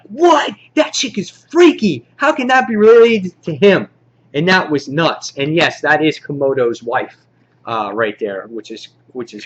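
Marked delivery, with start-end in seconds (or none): 0.0-3.9 very high, louder disbelief; mock shocked outburst. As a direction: act out an incredulous reader's reaction. Acted shocked reaction to twist.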